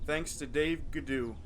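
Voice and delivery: normal talking voice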